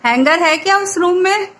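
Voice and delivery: loud household shout-out, rising at the end; calling out. Real, not acted. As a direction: call the question through to the next room. Asking someone in another room.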